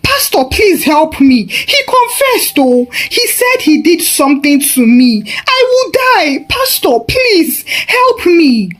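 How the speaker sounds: high, frantic pleading; cling to the pastor, voice leaping high; frightened mother begging the pastor for help; desperate mother. Acted.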